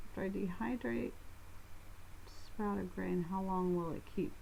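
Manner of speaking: soft mumble under the breath; mumbling. Real, not acted. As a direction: mumble it quietly to yourself. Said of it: "instead of mumbling it".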